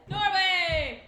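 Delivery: loud, playful shout of the name; excited exclaim. Acted. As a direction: boom it out dramatically. Repeats child's answer with gusto.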